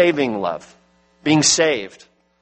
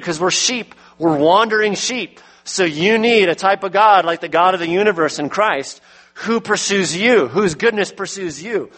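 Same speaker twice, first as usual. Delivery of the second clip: raised, pressing sermon voice; impassioned pulpit delivery; preaching at full force; 5 semitones higher than usual. Acted. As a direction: drive it home to the congregation.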